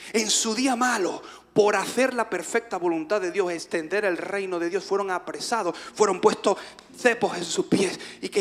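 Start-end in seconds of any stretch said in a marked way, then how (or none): none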